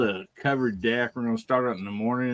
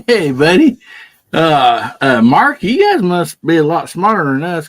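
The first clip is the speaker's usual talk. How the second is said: loud hearty greeting; 4 semitones higher, 11 dB louder than usual. Real, not acted.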